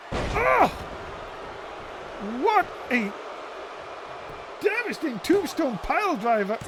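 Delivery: sudden high excited yell; excited shout. Real, not acted. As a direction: shout it in shock at the move. Big move, commentator erupts.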